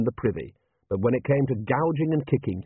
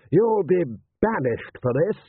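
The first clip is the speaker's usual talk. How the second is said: slow, higher-pitched indignant threat; shouted; 7 semitones higher, 3 dB louder, slower than usual. A narrator's reading. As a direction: mildly shout it as an outraged lord. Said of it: "Rust shouted".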